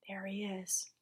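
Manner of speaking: soft whispered aside. Real, not acted. Storyteller pointing at the picture, whispering. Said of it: "whispered aside".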